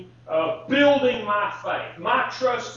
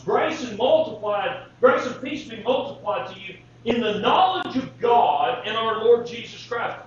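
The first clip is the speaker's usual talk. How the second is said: forceful scripture quote; 4 dB louder than usual. Acted.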